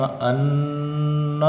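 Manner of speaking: ان hummed long through the nose; held nasal ghunna. Shown as a demonstration. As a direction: hold the نون in ان nasally. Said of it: "حمزہ نون زبر ان". (ناک میں روک کر ان)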